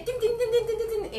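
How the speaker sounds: high chanted "tin tin tin" drumroll; mock fanfare on a high note; sung fanfare jingle. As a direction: sing out a little celebratory jingle. Mimics a fanfare after validé.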